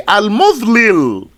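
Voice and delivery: "Al-Mudlil" with two separate l sounds; original unmerged form. Shown as a demonstration. Teacher showing what you'd originally say, "Al-Mudlil" with both lams apart. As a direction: split "Al-Mudlil" so l starts and ends the last syllable. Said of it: "originally you'd say".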